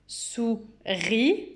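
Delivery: "Souris" split, ending on a rising held "ri"; slow "Sou-ris" to show the final sound; syllable by syllable. Shown as a demonstration. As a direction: say "sou", pause, then stretch "ri". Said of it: "j'entends le son I".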